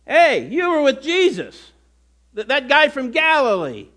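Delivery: a hint of a servant girl calling him out; accusing servant girl.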